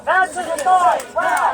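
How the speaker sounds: loud insistent yell; shouted demand. Real, not acted. Protester yelling at cyclists to wear helmets, loud. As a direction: drive the demand home.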